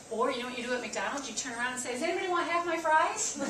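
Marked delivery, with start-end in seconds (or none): 1.9-3.3 higher and louder, laughing; mock call-out. Pretending to offer fries to strangers, laughing call-out. Calling out in a restaurant.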